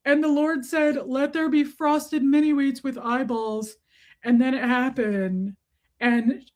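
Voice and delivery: doting mock-reverent storyteller; pet-talk storytelling. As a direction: tell a little dog a mock Bible tale. Tells dog a mock creation story.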